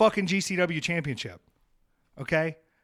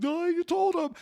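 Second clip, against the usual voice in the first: mimicking a childish complaint; whiny mock voice. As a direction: squeal it like a tattling kid. Mocking a petty quarrel.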